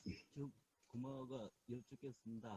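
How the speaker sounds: barely audible phone line; far-off, faint call audio; faint connection. Real, not acted. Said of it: "잘 안 들려요". (멀리서 작게 들리는 통화 소리)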